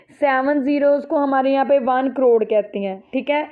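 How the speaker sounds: normal talking voice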